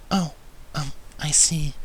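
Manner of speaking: soft, slow, bashful; shy quiet reply. A narrator's reading. Fluttershy bashfully answers his compliment, slow and soft. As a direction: trail off shyly. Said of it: "Fluttershy whispered quietly".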